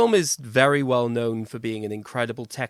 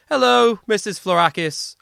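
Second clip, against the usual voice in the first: high, loud hello to a listener; cheery shout-out; 7 semitones higher, 6 dB louder than usual. Pretend. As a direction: call out a greeting to a friend's mother. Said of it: "I just wanna say a special shout out".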